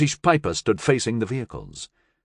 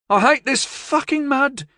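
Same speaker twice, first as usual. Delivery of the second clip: frustrated. Acted. Slipping in mud.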